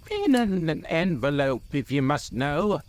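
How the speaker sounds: high-voiced hobbit, mildly impatient. A narrator's reading. Bilbo answers Gandalf about the ring, impatient. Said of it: "said Bilbo impatiently".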